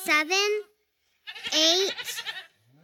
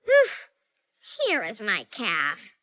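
ordinary delivery, nothing marked

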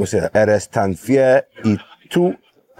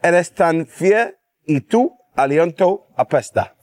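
phrase broken up for a learner; easy-to-repeat Spanish model; careful teaching demo; 7 semitones higher than usual. Shown as a demonstration. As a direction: say it in clear, separate chunks, pitched up. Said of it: "Make it easy".

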